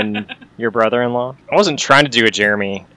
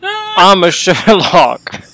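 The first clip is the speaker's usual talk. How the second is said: slow, higher, louder mock British Sherlock; Sherlock impression; 7 semitones higher, 6 dB louder, much slower than usual. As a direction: drag it out as a hammed-up Sherlock. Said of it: "That's a good Benedict Cumberbatch".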